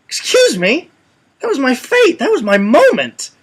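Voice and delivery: high-pitched mock indignation; put-on, high-voiced protest; indignant. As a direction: act out a man offended at being robbed of his moment. Voicing indignant man robbed of fate.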